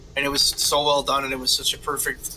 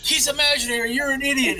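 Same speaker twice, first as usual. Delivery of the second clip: higher, dismissive put-down; mocking, much higher scolding; mock exasperated retort; 10 semitones higher than usual. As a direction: snap it at him like he's a fool. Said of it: "Daniel Bryan's like".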